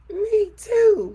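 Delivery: very high, loud little cub voice; tearful Baby Bear. A narrator's reading. Baby Bear agreeing through tears, very high. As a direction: squeak it like a teary baby bear. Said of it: "said Baby Bear".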